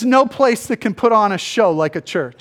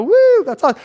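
mock cheer; 9 semitones higher, 5 dB louder, faster than usual. Mock enthusiastic onlooker.

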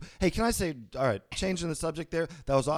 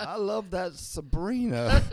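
put-on old man voice; 4 semitones higher, slower than usual. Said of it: "he said".

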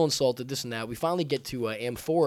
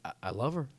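feigned lovesick sincerity; 3 semitones lower, 7 dB quieter, faster than usual. Acted. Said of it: "I'm pretty convincing".

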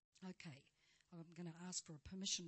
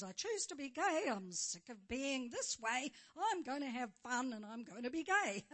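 mock declaration; 4 semitones higher, 8 dB louder than usual. Hypothetical person declaring choice.

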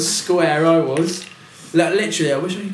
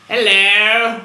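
loud hello; 6 semitones higher, 8 dB louder than usual. Shown as a demonstration. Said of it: "That one was funny".

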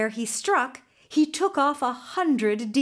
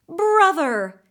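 loud, high horse's whinny of a word; whinnied call; 8 semitones higher, 8 dB louder than usual. Acted. Horse greets his long-lost brother with a loud whinny. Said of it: "he whinnied".

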